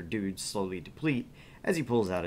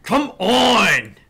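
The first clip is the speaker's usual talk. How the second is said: furious yell, much louder; angry shout; 11 semitones higher, 13 dB louder than usual. Acted. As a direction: bellow it impatiently. Angry customer shouting in scene.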